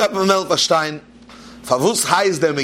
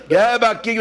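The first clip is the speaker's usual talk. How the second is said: calling out; 7 semitones higher than usual. Acted. Drunk calls to his companions.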